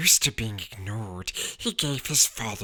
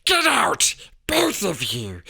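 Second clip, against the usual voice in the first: high, loud barked order; croaked order; 8 semitones higher, 4 dB louder than usual. A narrator's reading. Uncle ordering both boys out of the kitchen. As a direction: throw them out sharply. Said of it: "croaked Uncle Vernon".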